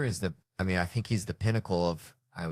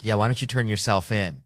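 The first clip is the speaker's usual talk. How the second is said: ordinary delivery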